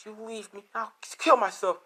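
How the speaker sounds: high voice swelling into a loud threat; acted desperate threat. Rose threatens if left.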